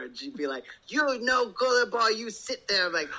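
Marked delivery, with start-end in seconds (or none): 0.9-2.9 voicing the bossy daughter. Daughter voiced in Barbie play.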